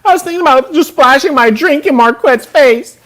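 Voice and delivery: high mocking quote of Adam. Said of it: "this boy's a hoe".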